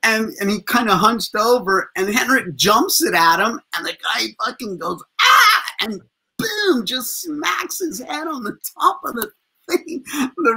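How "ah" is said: sudden high, loud "ah!"; startled yell. Retold or imitated. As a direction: yelp like a man caught off guard. Guy startled by thrown raccoon puppet.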